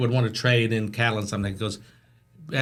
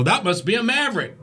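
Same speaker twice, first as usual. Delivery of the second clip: cowboy's remark; 11 semitones higher than usual. Retold or imitated. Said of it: "and they'd go".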